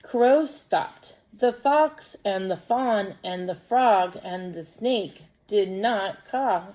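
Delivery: slow, deliberate story reading; children's story read-aloud. Acted. Teacher reading a crow story to pupils, slow storytelling. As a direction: read slowly and clearly for young pupils.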